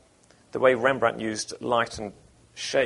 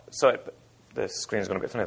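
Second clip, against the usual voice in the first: ordinary delivery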